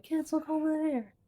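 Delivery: high, soft, drawn-out imitation; imitating co-host's catchphrase. Co-host calling kids' attention to the mountains, high soft mimicry. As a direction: mimic the co-host pointing out a view, softly and slowly. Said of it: "but you are like".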